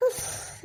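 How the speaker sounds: soft, voiceless "uff"; exhaled sigh. Acted. Husband once mother-in-law gives up on cooking, sighing. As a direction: let out a long quiet breath.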